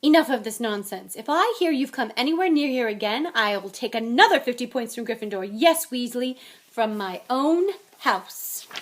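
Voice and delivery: loud, indignant scolding; storming. A narrator's reading. McGonagall threatening to dock house points, storming. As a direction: lay down the law, loud and angry. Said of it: "she stormed".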